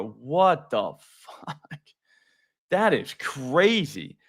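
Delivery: very slow, drawn-out amazement; stunned disbelief. Real, not acted. Reacting to a hundred-mile average, slow stunned disbelief. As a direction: stretch each word out in disbelief.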